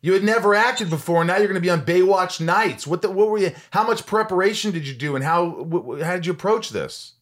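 high and loud, rushing the questions; excited. Real, not acted. Host amazed she'd never acted before, excited and loud. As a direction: tumble over your own words. Host thrilled by the audition story.

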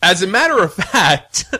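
loud high laugh through words. Real, not acted. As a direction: laugh as you talk. Laughing mid sentence.

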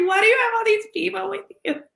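high, quick mock questioning; light, high-pitched impression with a laugh behind it. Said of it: "He was like".

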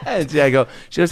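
ordinary delivery

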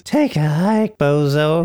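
high, sneering put-on voice; bratty kid. Kid dismissing the helpful stranger, bratty. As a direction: brush him off like a cheeky kid. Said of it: "he just says".

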